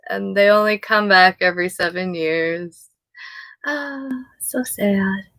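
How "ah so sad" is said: a slow, whispered sigh of pity; sad whisper. Real, not acted. Reader whispers her sorrow for the selkie story. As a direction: breathe it out slowly, heartbroken.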